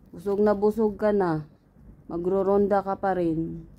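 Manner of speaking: ordinary delivery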